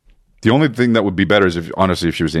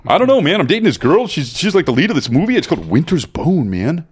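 high, swooping dude voice; hammed-up bro moaning, easing down toward the end; mock whiny friend; 8 semitones higher than usual. Retelling friend dating the movie's lead, mock whiny bro. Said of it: "He was like".

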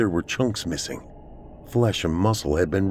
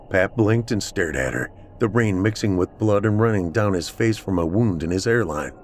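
usual speaking voice

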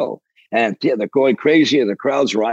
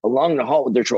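ordinary talk

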